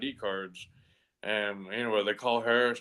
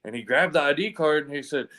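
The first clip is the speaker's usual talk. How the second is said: ordinary talk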